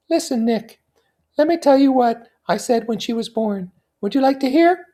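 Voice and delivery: put-on high young woman voice; Daisy voice. A narrator's reading.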